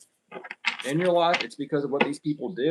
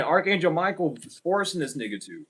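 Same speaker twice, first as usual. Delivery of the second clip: ordinary delivery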